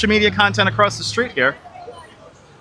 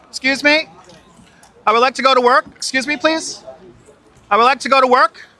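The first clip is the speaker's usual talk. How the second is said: high polite voice; 5 semitones higher than usual. Pretend. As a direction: sweetly beg to pass. Repeating plea through crowd.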